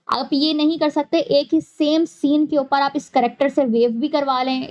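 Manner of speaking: normal talking voice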